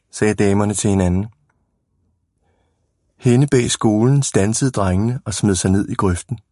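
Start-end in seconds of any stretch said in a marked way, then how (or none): none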